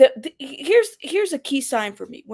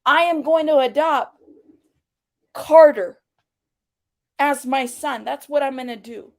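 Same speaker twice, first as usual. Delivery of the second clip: off-the-wall proclamation; 2 semitones higher, 6 dB louder than usual. Retold or imitated. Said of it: "she'll say the most off the wall shit".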